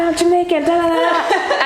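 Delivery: high-pitched, playful fake Jamaican singsong; attempted Jamaican accent. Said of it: "tried to, like, do the accent".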